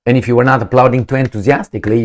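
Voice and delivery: usual speaking voice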